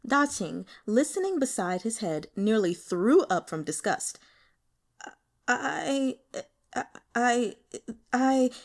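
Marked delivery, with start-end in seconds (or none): 5.5-8.5 halting, stuck on I; timid stuttering start; nervous stammer. A narrator's reading. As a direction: stammer like a shy kid. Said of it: "stammering the whole time".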